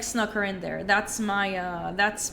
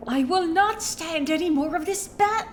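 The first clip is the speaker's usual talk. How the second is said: Jennifer Coolidge voice; 6 semitones higher, 3 dB louder than usual. Acted. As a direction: start as a pampered rich lady. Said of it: "my bad Jennifer Coolidge impression".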